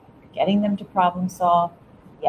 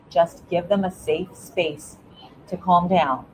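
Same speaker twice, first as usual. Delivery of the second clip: normal talking voice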